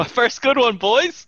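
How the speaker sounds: thrilled player whooping to the table; excited cheer. Real, not acted. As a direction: crow about finally rolling well. Player celebrates a good roll.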